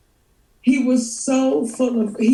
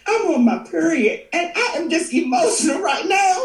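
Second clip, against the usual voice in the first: higher, hammed-up tearful whine; dramatic mock crying; 5 semitones higher than usual. Host confessing to her worried friend, dramatic mock crying. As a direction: wail it tearfully, half laughing. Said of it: "I'm dramatic, okay?".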